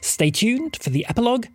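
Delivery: ordinary delivery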